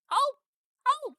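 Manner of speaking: happy squawk. Acted. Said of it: "she happily squawks".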